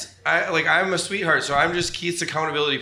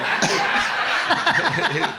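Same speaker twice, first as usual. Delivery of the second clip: laugh; 4 semitones higher, 4 dB louder than usual. Real, not acted. Cracks up mid-story.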